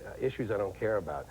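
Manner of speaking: normal talking voice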